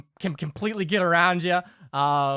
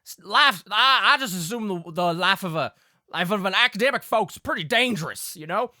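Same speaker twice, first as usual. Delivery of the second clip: higher, folksy country father; wary Southern Pa; 5 semitones higher, 4 dB louder than usual. Acted. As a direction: warn them like a suspicious country pa. Game master plays Pa.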